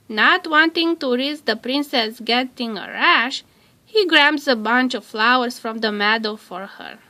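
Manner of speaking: usual speaking voice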